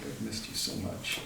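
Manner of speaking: soft, half-voiced confession; tearful sweetheart. Game master as the man leaning on her shoulder, softly emotional. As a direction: murmur it with mock emotion. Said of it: "you can hear him kinda sniffle a little".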